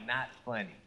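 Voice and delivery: mock grave demand; stern wife. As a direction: order revenge like a cold queen. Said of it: "She looks at him like".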